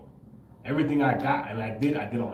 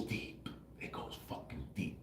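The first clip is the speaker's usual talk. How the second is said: drops to a low whisper; hushed whisper; 11 semitones lower, 18 dB quieter than usual. Real, not acted. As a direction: let it sink to a breath. Stressing depth of spiritual truth.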